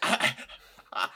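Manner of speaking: mock breaking into tears, high-pitched; cartoon bartender close to tears, half laughing; Moe. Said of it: "where he starts breaking into tears".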